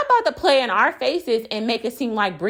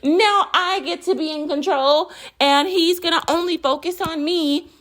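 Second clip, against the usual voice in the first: higher, smug voice as the rival; a higher, gloating mock Brittany; 8 semitones higher than usual. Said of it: "And she's like".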